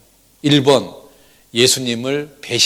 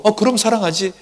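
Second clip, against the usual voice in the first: casual, higher throwaway answer; husband answering his wife half-heartedly; offhand reply; 8 semitones higher than usual. Retold or imitated. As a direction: toss it off without thinking. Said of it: "처음에는 건성으로".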